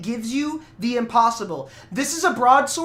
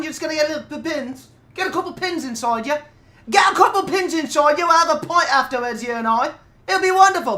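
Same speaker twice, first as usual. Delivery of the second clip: louder hammed-up Cockney demon; Cockney accent; 3 semitones higher, 3 dB louder than usual. Pinhead inviting the lass to hell, in Cockney. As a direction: cheerfully drag her down to hell, Cockney style. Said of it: "Yeah, that was Cockney".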